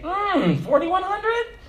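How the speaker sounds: high, sly mock landlord voice, quick at the end. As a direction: ham up a greedy landlord spotting a bigger rent. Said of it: "They like to say".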